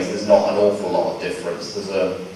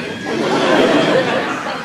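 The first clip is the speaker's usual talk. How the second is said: a burst of high laughter; delighted high giggle; high breathy laugh; 3 dB louder than usual. Real, not acted. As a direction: laugh high and airy at the memory. Laughing at own impression.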